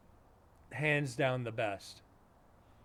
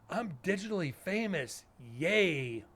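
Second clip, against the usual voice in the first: higher-pitched, hammed-up boast with a drawn-out yay; mock self-important gushing; 4 semitones higher, slower than usual. Streamer mocking people promoted in a server, mock gushing. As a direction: crow it in mocking, put-on excitement.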